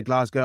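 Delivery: "Glasgow" with a long AH, GLAHZ-go; British "Glasgow" with broad A and Z sound. Shown as a demonstration. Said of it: "I always say Glasgow".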